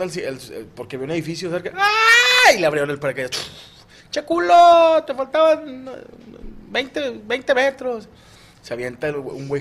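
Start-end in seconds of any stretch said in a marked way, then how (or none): 1.8-2.5 sudden high falling scream. Acted. Skydiver screams near the ground. 4.1-8.1 high, loud mocking taunt; mocking jumper. Argentine-style voice mocks the gringo.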